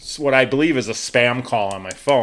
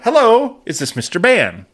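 imitated caller with a high hello that drops away; telemarketer; 5 semitones higher than usual. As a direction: greet like a cold caller, high then settling. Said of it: "I'm preparing for".

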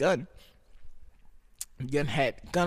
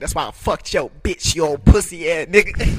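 loud mock [name] diss; loud hammed-up [name] insult; 3 semitones higher, 14 dB louder than usual. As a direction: spit it like [name] dissing a rival. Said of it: "I felt like [name]".

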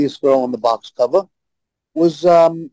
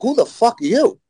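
retold indignation; 7 semitones higher than usual. Insulted woman challenging a stranger, indignant. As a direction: imitate her mad, higher-pitched challenge. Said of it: "she was mad".

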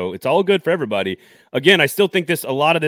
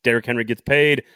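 ordinary delivery

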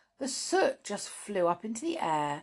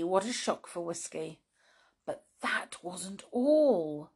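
ordinary delivery

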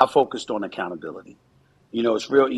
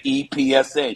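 put-on light West Indian accent, a bit higher; 6 semitones higher, 3 dB louder than usual. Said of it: "with a West Indian accent".

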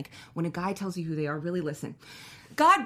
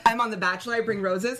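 normal talking voice

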